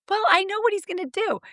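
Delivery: imitating client. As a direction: whine it up high. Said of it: "they're like".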